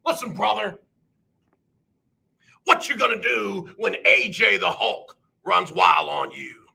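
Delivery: a lighter Hogan promo voice; Hulk Hogan impression. Streamer finishing the Hogan bit for chat, lighter voice. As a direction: toss off the catchphrase Hogan-style. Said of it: "impersonator for Hulk Hogan".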